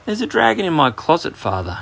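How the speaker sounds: high, politely voiced little announcement. A narrator's reading. Boy tells his busy father about the dragon, politely. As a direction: pitch it up high and politely, dropping on the last word. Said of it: "he said politely".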